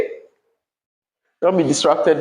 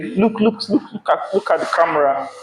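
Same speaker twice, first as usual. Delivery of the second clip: soft, teasing voice of a pupil nudging a friend; mock student; 4 dB quieter than usual. Teacher mimics a pupil telling a friend to look at the camera. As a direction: lightly imitate a student whispering to a friend.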